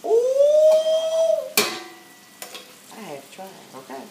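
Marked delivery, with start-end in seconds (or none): 0.1-1.5 high squealing ooh, rising to a loud peak; excited ooh. Real, not acted. Cook tells viewers how the dish looked to her, delighted high squeal. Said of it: "Hey, look at me".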